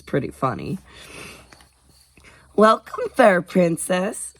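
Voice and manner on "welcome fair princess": giggling. A narrator's reading. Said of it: "Skylar giggled".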